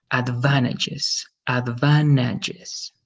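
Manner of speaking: very slow "Advantages" as ad-VAN-a-jes; slow teacher's model of "Advantages" without the T. Shown as a demonstration. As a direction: stretch out "Advantages", dropping the T. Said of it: "Remember, no T".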